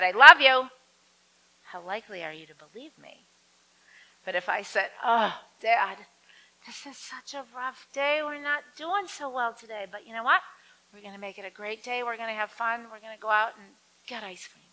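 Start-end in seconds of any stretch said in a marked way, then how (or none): 0.0-0.7 barked out, much louder and higher; a loud, hostile I love you; harsh I love you. Shown as a demonstration. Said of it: "They can read the tone of our voice". 5.0-14.6 cheerful and higher, coaxing dad along; reassuring a struggling father, upbeat and higher. Shown as a demonstration. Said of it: "But my tone of voice said".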